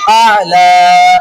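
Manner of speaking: आला loud, with the last "aa" held long; drawn-out, ringing final vowel on आला; long standing vowel. Shown as a demonstration. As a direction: recite आला slowly, stretching "laa". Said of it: "वाक़फ़न".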